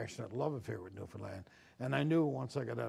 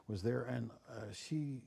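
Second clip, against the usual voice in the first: normal talking voice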